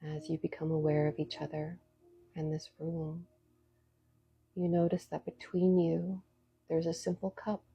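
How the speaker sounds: soft spa whisper. Acted. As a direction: lead them gently into calm. Leads a guided meditation.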